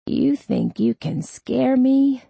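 slow raspy challenge rising high. A narrator's reading. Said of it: "his voice raspy".

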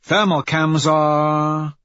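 high voice, stretching words while working; Pebble, hacker at work. Acted. Pebble shutting off security from the datapad, drawn-out reporting. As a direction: draw out each status until it completes.

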